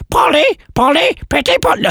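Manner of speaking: very high parrot squawk. Acted. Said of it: "So a law-breaking parrot there".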